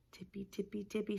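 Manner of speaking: soft whispered tippy, tippy; sneaky hushed read. A narrator's reading. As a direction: whisper like tiptoeing bunnies. Bunnies sneaking again.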